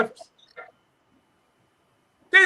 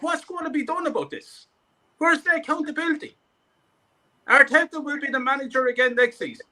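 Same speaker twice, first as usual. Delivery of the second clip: ordinary talk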